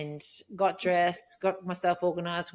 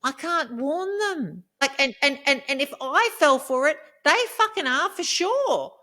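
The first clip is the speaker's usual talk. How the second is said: real upset venting; 7 semitones higher, 4 dB louder than usual. Real, not acted. Woman upset she can't warn the other women, impassioned. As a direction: let the anguish climb, stretching the key words.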